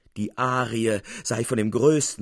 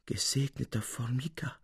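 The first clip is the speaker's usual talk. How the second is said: soft murmur, much quieter; lisping to himself; 8 dB quieter than usual. Acted. Capuzzi lisping softly to himself about Formica. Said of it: "lispelte Signor Capuzzi vor sich hin".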